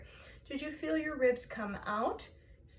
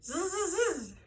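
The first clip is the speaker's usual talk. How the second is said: three voiced zzz buzzes; buzzing zzz pulses; 5 semitones higher, slower than usual. Shown as a demonstration. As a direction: buzz each z with a belly tuck. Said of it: "repeat after me".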